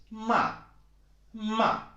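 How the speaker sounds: "ma" starting high and falling sharply; emphatic falling Mandarin "ma"; fourth tone. Shown as a demonstration. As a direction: throw "ma" down from a high, loud start. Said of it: "start high and you go down".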